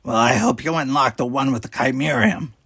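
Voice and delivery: a sharp, irritated snap; snapped. A narrator's reading. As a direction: snap it back with impatience. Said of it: "Talos snapped".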